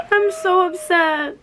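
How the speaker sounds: high cry-laugh then slow; half laughing, half upset; real laughing distress. Real, not acted. Showing friend her bare nose.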